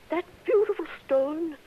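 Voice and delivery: a catch of tears over the lost stone; tearful lament. Acted. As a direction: near tears, grieving for her emerald. Woman mourns her stolen emerald.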